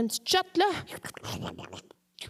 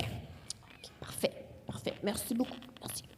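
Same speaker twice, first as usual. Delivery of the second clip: barely voiced okay and parfait; whispered aside; 7 semitones lower, 14 dB quieter than usual. Aside during the relay call, a hushed whisper. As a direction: breathe it so the doctor won't hear.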